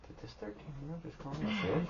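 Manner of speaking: high, hushed incredulous voice; scandalized mock question; mock disbelief. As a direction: imitate a stunned nurse under her breath. Listener voices the nurse's reaction.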